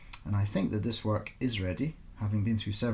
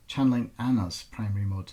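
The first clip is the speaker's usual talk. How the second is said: plain reading voice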